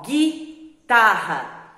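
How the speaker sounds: guitarra drawn out slowly as gitarra, U silent; slow sounding out. Shown as a demonstration. Teacher sounding out a word for pupils, very slow. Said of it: "Eu não falo separado G U".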